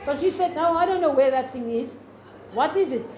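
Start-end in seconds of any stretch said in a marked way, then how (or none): none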